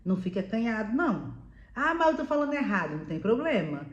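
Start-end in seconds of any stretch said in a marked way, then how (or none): 1.8-3.0 higher, quick mock objection; imitated worried learner. Learner objecting about making mistakes, mock worried voice. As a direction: put on a learner's fretting voice.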